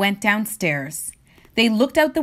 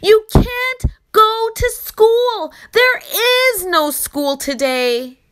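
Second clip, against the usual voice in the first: both yelled; 8 semitones higher, slower than usual. Acted. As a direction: bellow it as exasperated parents. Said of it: "both yelled".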